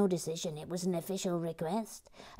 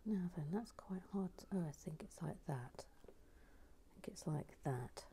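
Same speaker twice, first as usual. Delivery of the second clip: quiet concentration; 13 dB quieter than usual. Real, not acted. While fitting a tricky piece, quiet concentrated whisper. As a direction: murmur to yourself as you fit pieces. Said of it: "building set quietly".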